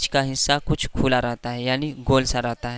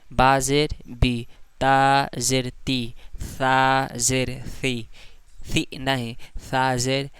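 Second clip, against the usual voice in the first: each letter read out slowly; slow recitation demo; slower than usual. Shown as a demonstration. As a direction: recite slowly so the class can follow. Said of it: "इस तरह।".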